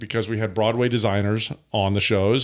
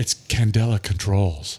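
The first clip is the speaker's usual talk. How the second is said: low, slow sales pitch voice; marketing voice; 5 semitones lower, slower than usual. Real, not acted. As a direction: announce the company like an ad. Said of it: "That's a marketing voice".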